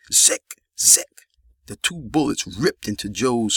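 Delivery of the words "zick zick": whispered gunshot sound. A narrator's reading. Silenced gunshots imitated.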